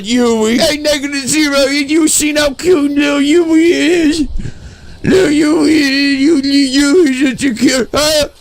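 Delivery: high doting coo; high, adoring baby talk; gushing. Pretend. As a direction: swoon over how cute she is. Gushing over cute character.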